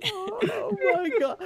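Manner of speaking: high, slow, laughing wail. Real, not acted. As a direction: gasp it out through laughter. Cracking up at friend's joke.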